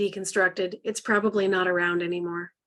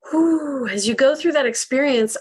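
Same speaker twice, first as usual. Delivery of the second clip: relieved whew; 5 semitones higher, 8 dB louder than usual. Real, not acted. Exhale after group affirmation.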